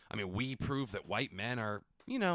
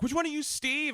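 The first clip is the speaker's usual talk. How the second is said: squeaky high fan question; high mock fan voice; 4 dB louder than usual. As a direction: pitch way up as a fan. Imagined fan asking band.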